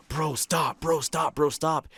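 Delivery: retold urgent pleading with a buddy. Begging a friend to stop the Ouija board, urgent pleading.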